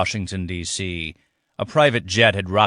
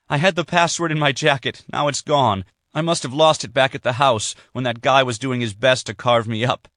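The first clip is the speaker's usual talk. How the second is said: put-on lightly higher, anxious young woman; distressed Sydney; 5 semitones higher than usual. Sydney tells her father the password is gone, anxious. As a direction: explain the loss with rising worry.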